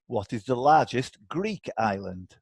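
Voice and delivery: ordinary talk, nothing marked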